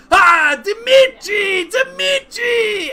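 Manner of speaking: very high, drawn-out shout of a name; overjoyed shouted greeting. Acted. Friend spots Dimitri and runs to embrace him, elated shout. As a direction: holler it long and high in character.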